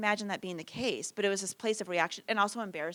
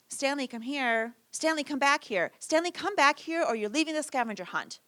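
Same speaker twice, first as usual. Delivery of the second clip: calling out, building; 7 semitones higher, 4 dB louder than usual. Retold or imitated. Mom calling the running child.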